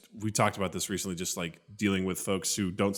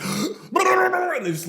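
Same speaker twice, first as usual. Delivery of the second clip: gasp; 4 dB louder, much slower than usual. Retold or imitated. Gasp imitation.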